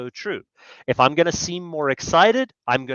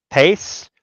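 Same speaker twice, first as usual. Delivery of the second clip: ordinary talk, nothing marked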